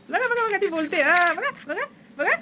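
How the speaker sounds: high, eager "look, look" as the bird talks; excited high. Real, not acted. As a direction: urge them to look, pitch jumping up.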